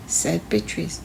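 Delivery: plain reading voice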